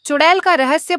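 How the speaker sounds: ordinary delivery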